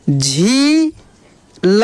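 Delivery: long झी, pause, then ल; drawn-out syllables. Shown as a demonstration. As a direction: sound out झी ल with the ī held. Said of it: "खींच कर किया जाता है". (झी खींच कर, फिर ल)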